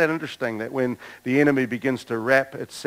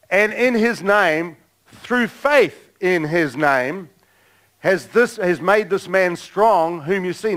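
slow, raised scripture reading; higher, emphatic preacher's reading; scripture declamation; 5 semitones higher, slower than usual. Real, not acted. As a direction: proclaim the verse phrase by phrase. Reads scripture aloud.

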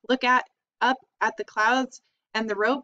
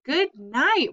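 higher, louder disgusted cry; mock scream; 6 semitones higher, 4 dB louder than usual. A narrator's reading. Avery gagging on the stink, high and loud. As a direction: cry out at the smell. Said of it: "screamed Avery".

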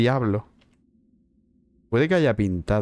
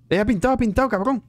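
excited shout; 10 semitones higher, faster than usual. Real, not acted. Ghost finally painted.

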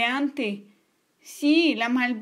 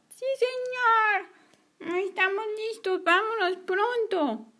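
put-on high, slow little kid reply; child voice; 9 semitones higher, slower than usual. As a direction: answer like an eager small child. Kids answer the policeman.

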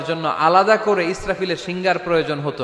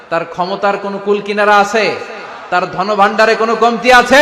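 rising shout; 4 semitones higher, 6 dB louder than usual. Real, not acted. Preacher building to a rhetorical climax.

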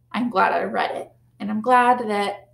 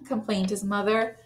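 plain reading voice

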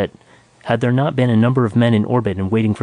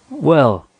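bright, higher call of greeting; cheerful hello, pitched up; cheerful cry; 4 semitones higher, 3 dB louder than usual. A narrator's reading. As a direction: hail him cheerfully. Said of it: "cried a cheerful voice".

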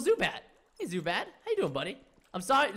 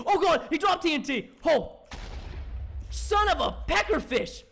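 startled shout; 8 semitones higher, 6 dB louder than usual. Real, not acted. TNT dropped in fight.

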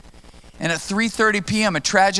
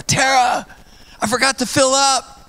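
panicked call; 8 semitones higher, 3 dB louder than usual. Retold or imitated. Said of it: "I go".

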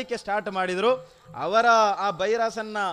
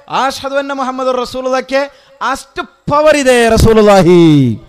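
very loud, raised proclamation; full-force preaching; 5 semitones higher, 15 dB louder than usual. Real, not acted. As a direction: thunder out the good news to the crowd. Full-force preaching of angel's message. (ಅಬ್ಬರದ ಪ್ರವಚನ)

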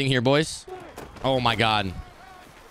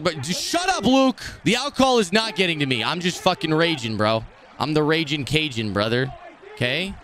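ordinary delivery

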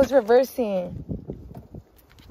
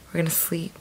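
whispering; 3 semitones lower, 8 dB quieter than usual. Real, not acted. Midnight, tired, ending vlog.

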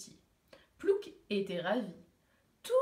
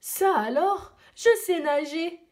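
higher, louder cry of amazed delight; astonished; 6 semitones higher, 6 dB louder than usual. Acted. Penguin discovers in the water that he can swim, astonished. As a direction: gasp it out, amazed. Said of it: "tout étonné".

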